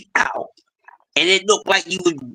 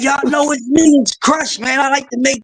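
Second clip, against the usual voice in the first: excited self-quote; 8 semitones higher than usual. Retold or imitated. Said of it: "I said".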